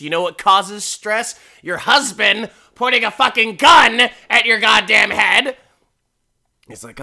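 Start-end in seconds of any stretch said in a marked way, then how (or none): 0.0-5.6 building to a high, loud shout; mock tirade that climbs into shouting; acted angry rant. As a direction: imitate a fed-up wife yelling at her husband. Acting out the wife's angry rant.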